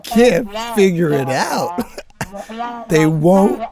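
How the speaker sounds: high, hammed-up bewilderment breaking into laughs; mock exasperation. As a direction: play at being driven mad by endless droning. Hammed-up frustration at droning teacher.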